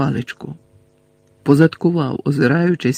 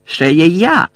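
raised, declaring voice; defiant call; 3 semitones higher, 4 dB louder, slower than usual. Acted. Friend announcing himself from above, defiant call. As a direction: announce yourself across the hall.

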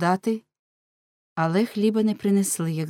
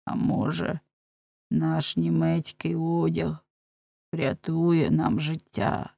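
slow, drawn-out worried hope; slow, plaintive clinging to hope; fretful lament; slower than usual. A narrator's reading. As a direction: wonder it aloud slowly, lamenting. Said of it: "бідкався Ламе".

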